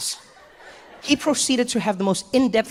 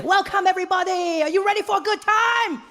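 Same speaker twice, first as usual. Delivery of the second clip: much higher, bright tour-guide shout; way too happy; 11 semitones higher than usual. Retold or imitated. Tour guide welcoming sleepy tourists, way too happy. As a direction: greet the tourists far too cheerfully. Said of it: "way too happy for that time".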